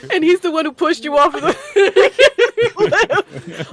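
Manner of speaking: fast, loud, laughing tease. Real, not acted. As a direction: spill it out through laughter. Teasing amid laughter.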